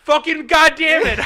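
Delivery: loud, high exploding curse; retold furious curse. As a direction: blow up at the empty cabinet. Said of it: "And I was like".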